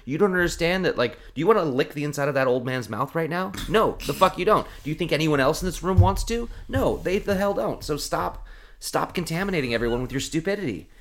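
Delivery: high, exasperated rant; worked up. Retold or imitated. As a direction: scold a careless coworker, pitch climbing. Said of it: "I'm getting worked up again".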